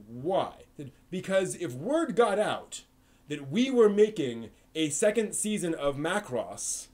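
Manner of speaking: ordinary talk, nothing marked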